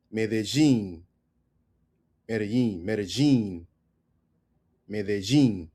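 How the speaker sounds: "Medellin" as meh-deh-ZHEEN; soft J sound. Shown as a demonstration. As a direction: soften the ll of "Medellin" to zh, stress the end. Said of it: "soft J sound when you pronounce".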